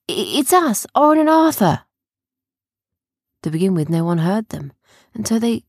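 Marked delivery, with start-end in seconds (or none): 0.1-1.8 high, loud announcing call; calling from outside. A narrator's reading. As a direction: shout who has arrived. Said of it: "they shouted from outside".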